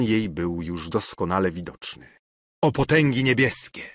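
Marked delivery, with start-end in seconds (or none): 2.6-3.8 mildly exclaimed appeal to heaven; exclaimed plea. A narrator's reading. Ksawery falls at Jadwiga's feet, mild exclamation. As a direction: cry out a little, as a narrator would. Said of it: "zawołał Ksawery, upadając jej do nóg".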